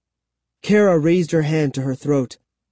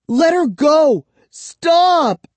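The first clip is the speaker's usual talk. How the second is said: high, loud pleading cry; desperate pleading; 13 semitones higher, 4 dB louder, much slower than usual. A narrator's reading. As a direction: beg loudly to make him stop. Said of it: "I pleaded".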